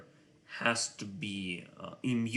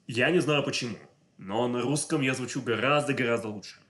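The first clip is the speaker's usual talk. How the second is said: louder, at ease in native Russian; real native Russian speaking voice; natural Russian voice; 2 semitones higher, 5 dB louder, faster than usual. Real, not acted. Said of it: "my English voice is completely different from my Russian voice".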